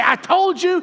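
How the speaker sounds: high, pointed mock reproach; mock told-you-so. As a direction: rub it in like a smug teacher. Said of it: "He doesn't look at him and say".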